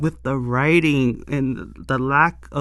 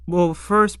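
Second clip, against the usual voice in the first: normal talking voice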